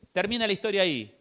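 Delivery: ordinary talk, nothing marked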